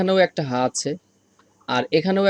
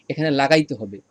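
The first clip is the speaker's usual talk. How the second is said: ordinary delivery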